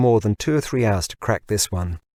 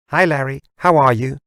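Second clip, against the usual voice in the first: higher, louder bright assistant greeting; device voice; 5 semitones higher, 6 dB louder than usual. Acted. Hologram assistant greets the user, higher and louder. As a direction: greet him like a chirpy machine.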